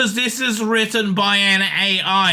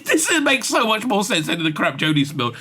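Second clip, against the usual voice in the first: ordinary talk, nothing marked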